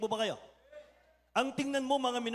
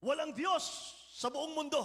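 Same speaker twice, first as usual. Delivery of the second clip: higher, ringing proclamation of faith; general's declaration; 9 semitones higher than usual. Retold or imitated. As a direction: lift the voice as the general. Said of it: "Sabi ng general". (mataas na boses ng heneral na nagpapahayag)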